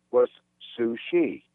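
ordinary delivery

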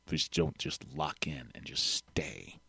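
slow soft whisper. Real, not acted. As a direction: whisper it slowly.

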